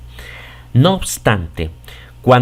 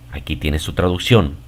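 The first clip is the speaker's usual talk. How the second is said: ordinary talk, nothing marked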